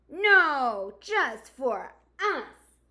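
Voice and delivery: loud. Acted. Animals refuse the newcomer.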